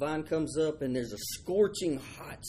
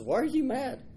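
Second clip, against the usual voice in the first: God asking; 10 semitones higher than usual. Acted. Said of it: "And God says".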